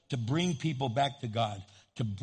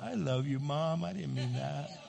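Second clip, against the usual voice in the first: lightly voiced child, meekly apologizing; a hint of a contrite kid; sorry little kid; 3 dB quieter than usual. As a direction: make up softly after a tantrum. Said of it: "by the time you get to the parking lot".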